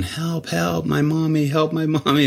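lightly voiced panicked kid; kid yelling. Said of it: "this kid yelling".

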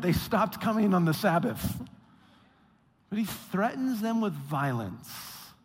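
ordinary delivery

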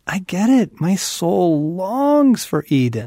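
ache aloud for what is missing; impassioned longing. Acted. Relating to rich neighbors' shoe shopping, impassioned longing.

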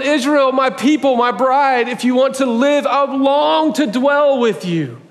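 high, pleading voice of God to his bride; voicing God pleading. God pleading with Israel, his bride, high and yearning. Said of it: "He's going".

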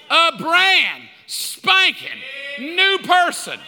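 loud, high, drawn-out declaration; triumphant proclamation. Real, not acted. Preacher proclaiming the believer made new, triumphant. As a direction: punch each word out slowly and loud.

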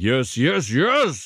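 mock triumph building to a high final yes; imitated triumph. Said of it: "there's a triumph in that last yes".

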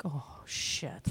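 unvoiced whispered oh shit; a muttered whispered curse to herself. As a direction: breathe it out under the breath. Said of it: "I was like".

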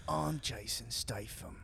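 put-on slow, soft British accent; Jason Statham. Guest naming himself as the star, slow British impression. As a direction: draw it out in a British action-star voice. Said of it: "I don't do Statham".

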